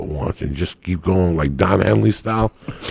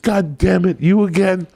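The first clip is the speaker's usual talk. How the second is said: higher, exasperated groan of a complaint; fed-up inmates; 9 semitones higher than usual. Retold or imitated. Cooks sick of Etchy's weekly drill, exasperated. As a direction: roll your eyes, not again.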